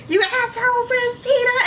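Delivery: very high, loud mock outrage; freaking-out yell. Heroine demands Peeta at the movie's ending, freaking-out yell. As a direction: shriek it like a hammed-up betrayed heroine. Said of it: "So I'm freaking out".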